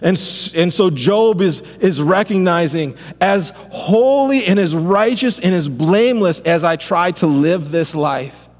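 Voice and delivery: fervent pastor preaching Job's cry; preaching at full force. Acted. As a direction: preach it out, rising to a climax. Builds sermon over music.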